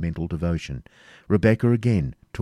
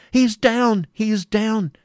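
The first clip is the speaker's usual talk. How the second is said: high, loud cry of alarm; shrieked exclamation; 6 dB louder than usual. A narrator's reading. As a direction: cry out in horror, pitched right up. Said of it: "uttered a loud shriek and exclaimed".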